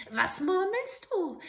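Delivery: a hint of a suspicious old hag; suspicious witch. Witch catching the soldier muttering, suspicious.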